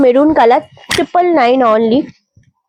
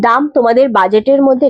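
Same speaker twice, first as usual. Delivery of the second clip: ordinary talk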